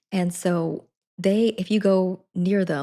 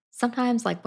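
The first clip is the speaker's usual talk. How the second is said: ordinary delivery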